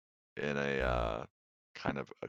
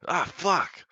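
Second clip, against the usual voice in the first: high, loud cry of pain; pained yelp; 7 dB louder than usual. Acted. Captive punched in the face, high pained shout.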